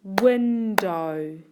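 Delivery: emphatic, measured "Window" for a spelling lesson; broken into syllables. Shown as a demonstration. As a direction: blend "Window" firmly from win and dow. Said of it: "listen to the sounds in each syllable".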